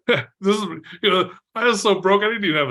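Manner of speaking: amused, laughing. Real, not acted. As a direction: break into a laugh while telling it. Amused at his own broke days.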